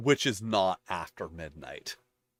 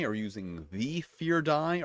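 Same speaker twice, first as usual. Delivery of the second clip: "the" said as "thee", pitched up; stress on THE; 5 semitones higher than usual. Shown as a demonstration. Reader contrasting dice wordings, "the" stressed as "thee". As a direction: hit "the" as "thee" in a pointed question. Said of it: "this is why there's important distinctions".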